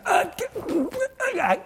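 strangled high croak, struggling to speak; imitated choking, no words getting out; choked gasp. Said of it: "it was choking me".

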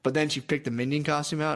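ordinary talk

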